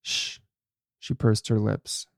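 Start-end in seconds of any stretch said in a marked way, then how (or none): 0.0-0.4 quiet hushing breath; soft shush. A narrator's reading. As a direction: hush him, very soft. Sister hushing him.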